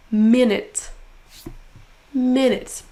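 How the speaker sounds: MIN in "Minute" strong, then falling; stressed syllable emphasized. Shown as a demonstration. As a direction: punch the first syllable of "Minute". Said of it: "en prononçant plus fort la syllabe". (première syllabe de « Minute » appuyée)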